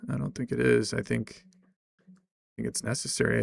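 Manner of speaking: ordinary talk, nothing marked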